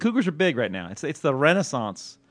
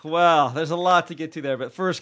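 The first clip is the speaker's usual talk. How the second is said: ordinary delivery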